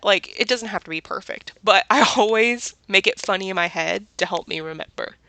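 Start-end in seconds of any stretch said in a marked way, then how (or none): none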